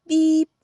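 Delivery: loud, bright horn beep; friendly beep. A narrator's reading. Friendly truck greeting a duck, loud beep. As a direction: beep it out cheerfully. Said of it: "said the friendly Little Blue Truck".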